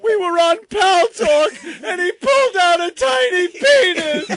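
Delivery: high-pitched, loud, laughing through tears; cracking up while telling it; laughing hysterically. Real, not acted. Crying with laughter.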